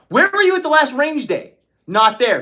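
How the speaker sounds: high barking drill-sergeant yell at chat; high mock rant. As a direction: chew out the viewers like a range instructor. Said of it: "They all think you're yelling at me".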